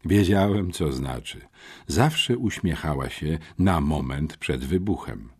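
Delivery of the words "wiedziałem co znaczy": usual speaking voice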